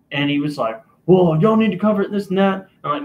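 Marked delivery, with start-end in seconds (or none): 1.1-2.7 higher-pitched mock complaining caller; put-on protesting voice; retold irate caller. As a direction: act out a customer arguing back.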